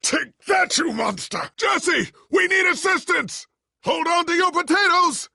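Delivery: high, loud fighting yells; rally and call for help mid-fight; battle shout. Acted. Battle cries during fight.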